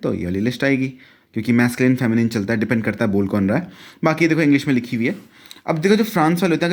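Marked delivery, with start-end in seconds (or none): none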